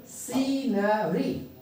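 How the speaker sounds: सीनरी slowly split into see, na, ree; slow, drawn-out syllables in सीनरी. Shown as a demonstration. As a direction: break सीनरी into separate syllables. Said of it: "अलग-अलग syllable को मैंने line खींच दिया".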